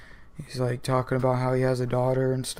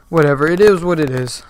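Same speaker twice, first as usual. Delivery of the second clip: exasperated; 3 semitones higher, 9 dB louder, faster than usual. Real, not acted. Exasperated shrug at plot hole.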